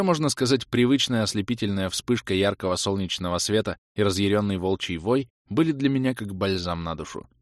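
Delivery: ordinary delivery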